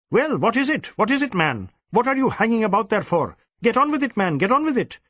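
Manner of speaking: hustle him out impatiently. Acted. Superintendent chasing the dawdling inspector, impatient.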